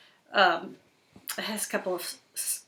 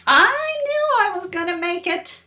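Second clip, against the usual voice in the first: slow, dramatic picture-book reading; reading to children; 3 semitones higher, 8 dB louder, slower than usual. Acted. Reading the fish story to Cooper, slow and dramatic. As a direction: draw the little listener into the story.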